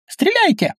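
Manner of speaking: very high shrieked order; squeal. A narrator's reading. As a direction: squeal the command in a piercing pitch. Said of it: "взвизгнула Астарта". (пронзительный визг)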